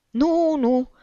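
high, frightened refusal; alarmed protest, voice jumping up. A narrator's reading. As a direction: say it in startled fear. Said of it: "făcu speriat Chistunov".

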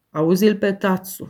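plain reading voice

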